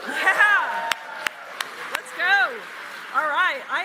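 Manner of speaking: loud rally hype greeting; podium speech. Real, not acted. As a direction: pump up the crowd, loud and high. Rally opener pumping up crowd.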